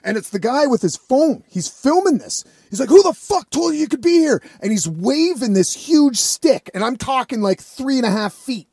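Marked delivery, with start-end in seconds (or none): 2.9-4.4 fast, high-pitched mock yell; imitated angry shout. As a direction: act out an irate man challenging a trespasser. Retelling angry man confronting him.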